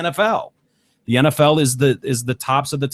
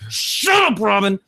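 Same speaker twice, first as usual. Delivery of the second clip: high-pitched, exasperated yell; 7 semitones higher, slower than usual. Retold or imitated. Viewer fed up with morning show host, yelling. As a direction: re-enact yelling at the television, voice shooting up. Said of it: "I, I yell".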